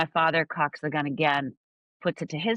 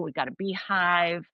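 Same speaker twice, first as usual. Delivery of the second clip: normal talking voice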